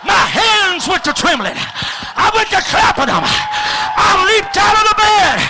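high, loud preacher's climax; preaching at full force. Real, not acted. Preacher telling the crowd how he leapt from bed, shouting. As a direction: build it to a shout.

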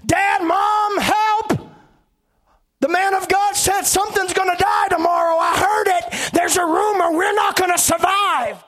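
very high, loud panicked scream; children calling to parents in panic. Acted. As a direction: shriek for help like a terrified child. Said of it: "the children are screaming".

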